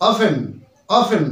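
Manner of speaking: careful, falling "o-fen" for অফেন; correct pronunciation. Shown as a demonstration. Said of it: "এরকম উচ্চারণ আসবে".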